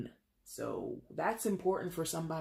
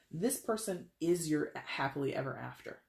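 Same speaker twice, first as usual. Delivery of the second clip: ordinary talk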